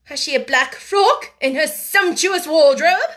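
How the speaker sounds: high, loud, slow sarcasm; scathing, deliberate question; bitter irony. A narrator's reading. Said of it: "in bitter irony".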